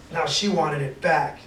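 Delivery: usual speaking voice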